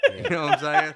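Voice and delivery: laughing aside. Real, not acted. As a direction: giggle the question out. Laughing tag after joke.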